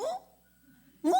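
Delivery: a sudden, very high startled "어?". Retold or imitated. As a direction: jolt at the shock. Startled seeing neighbour collapse.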